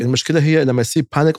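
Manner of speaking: normal talking voice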